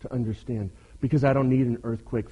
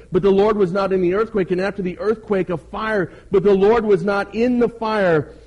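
raised, loud scripture proclamation; preach; 6 semitones higher, 6 dB louder than usual. A narrator's reading. Preacher proclaiming wind, earthquake and fire, forceful reading. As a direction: declaim each phrase with rising force.

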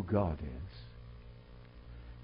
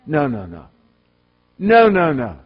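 emphatic no; 4 semitones higher, 14 dB louder than usual. Acted. Preacher rejecting seeker-friendly compromise.

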